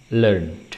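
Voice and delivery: "learned" as lernd, falling; teacher's repeat of "learned" in one syllable; correct one-syllable learned. Shown as a demonstration. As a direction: end "learned" on a plain d. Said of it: "tapi dibaca learned".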